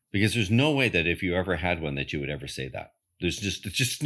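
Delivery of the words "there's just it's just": ordinary talk, nothing marked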